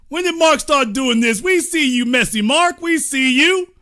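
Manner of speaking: loud, high teasing heckle that builds; excited call-out. Real, not acted. Commentator heckling Mark to his viewers, loud and teasing. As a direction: rise up and heckle him for the crowd.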